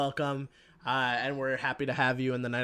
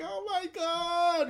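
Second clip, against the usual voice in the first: mock shock; much slower than usual. Characters react in shock.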